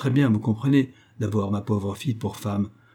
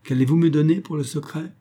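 sly; 6 semitones higher, 3 dB louder than usual. Acted. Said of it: "avec un horrible et malicieux regard".

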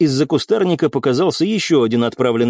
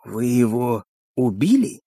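slow, silky question; insinuating; 3 dB quieter, slower than usual. A narrator's reading. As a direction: ask it slowly, creeping up on the answer. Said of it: "голос стал более вкрадчивым".

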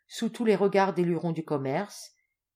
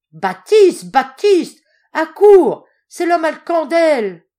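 shrieked, very loud and high; cracked trumpet voice; 9 semitones higher, 15 dB louder, slower than usual. Acted. Old woman shrieking to her husband in the next room. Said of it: "d'une voix de trompette fêlée, elle piaule".

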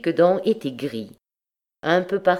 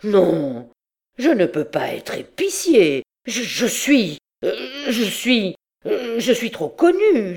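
indignant protest climbing higher as he stutters; stammering revolt; 5 semitones higher, slower than usual. A narrator's reading. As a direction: protest hotly, tripping over repeated words. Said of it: "Il eut une révolte".

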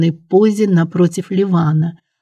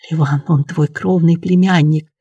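plain reading voice

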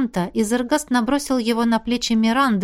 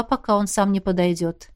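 usual speaking voice

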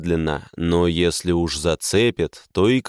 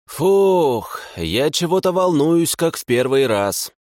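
nervous excitement; 6 semitones higher than usual. A narrator's reading. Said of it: "признался Семёнович".

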